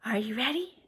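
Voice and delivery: soft, hushed whisper; quiet whispered question. Real, not acted. Hushed cue before the dancing verse.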